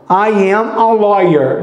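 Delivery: I am a lawyer, each word said apart, slowly; slow, separate words. Shown as a demonstration. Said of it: "que lenta de falar". (devagar, palavra por palavra)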